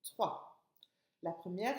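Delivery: usual speaking voice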